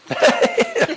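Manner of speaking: high burst of laughter; laughing. Acted. Listener hearing a friend claim to be God, laughing. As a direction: crack up at an absurd claim. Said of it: "And you're laughing".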